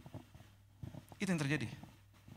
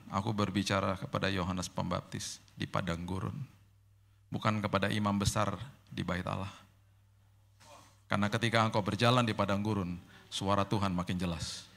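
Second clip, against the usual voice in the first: voice of God; 6 semitones lower than usual. Retold or imitated. Voicing God's reply.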